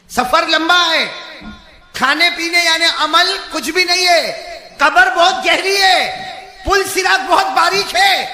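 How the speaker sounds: loud, high fire-and-brimstone sermon; shouted preacher's exhortation; full-force preaching. Acted. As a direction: thunder the warning at the whole crowd.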